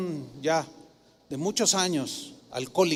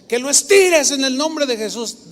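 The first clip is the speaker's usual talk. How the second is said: louder, higher insistence in Jesus' name; insistent command; 6 semitones higher, 7 dB louder than usual. Retold or imitated. Woman insisting the grandfather stretch his arm, loud insistent command. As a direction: press him firmly to stretch it.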